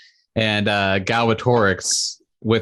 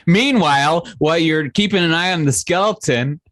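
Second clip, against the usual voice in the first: normal talking voice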